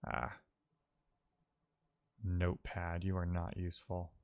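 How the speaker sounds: ordinary talk, nothing marked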